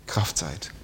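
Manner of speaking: hushed whisper. Acted. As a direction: let the phrase drop into a whisper. Preacher whispers the key phrase.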